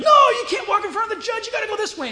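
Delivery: high, loud shout of a bailiff stopping her; sharp courtroom shout, loud and high. Retold or imitated. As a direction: bark the order across the courtroom. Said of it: "the bailiff and everybody goes".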